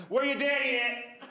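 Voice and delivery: high, slow, drawn-out question; nosy old-lady voice, stretching the words; old lady probing. Retold or imitated.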